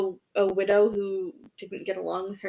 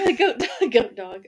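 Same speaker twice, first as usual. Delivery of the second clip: laughing; 2 semitones higher, 4 dB louder than usual. Real, not acted. Cracking up at 'goat dog'.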